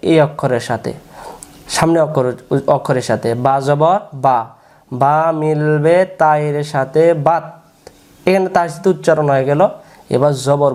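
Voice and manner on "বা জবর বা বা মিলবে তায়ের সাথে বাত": drawn-out, measured syllables; slow spelling drill. Shown as a demonstration. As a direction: spell it out slowly for pupils to repeat. Spelling drill recited for learners. (টেনে টেনে ধীরে বানান)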